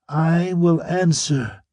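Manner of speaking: slow, high mechanical monotone. A narrator's reading. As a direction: answer like a hypnotized machine. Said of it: "she said in a mechanical voice".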